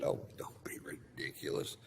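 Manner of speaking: hushed, breathy aside; whispered dismissal. Retold or imitated. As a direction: whisper it so the wife won't hear. Dismissing wife, dog nearby.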